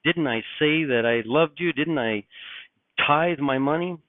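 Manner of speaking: imitating the rejected pleading to be let in; pleading at the door. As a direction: beg as if shut out at the door. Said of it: "knocking on that door".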